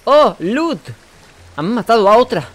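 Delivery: high, loud yelp of delight; excited outburst. Real, not acted. Gamer spotting loot mid-fight, high loud excited yelp. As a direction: blurt it out, thrilled by the find. Sudden excitement while playing.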